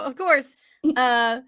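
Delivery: normal talking voice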